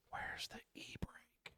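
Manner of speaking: slow, hushed whisper; whispered question. Retold or imitated. New yard dog driver asking coworker for help, slow whisper. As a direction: whisper it like an embarrassing confession. Retelling his sheepish question to Terry.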